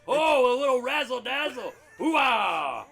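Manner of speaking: gruff bellowing army colonel; Frank Slade. Acted. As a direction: roar it like a blind old colonel. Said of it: "Lieutenant Colonel Frank Slade".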